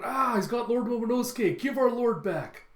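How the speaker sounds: high, loud imitated alarm; calling out. Samurai seeing their lord carried off, high alarmed shouting. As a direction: cry out in mock panic, dragging the demand. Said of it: "the other samurai calling out".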